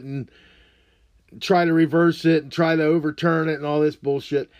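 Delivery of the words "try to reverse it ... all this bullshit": ordinary delivery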